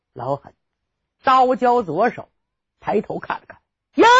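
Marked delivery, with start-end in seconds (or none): none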